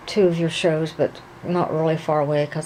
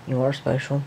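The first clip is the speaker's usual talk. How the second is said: normal talking voice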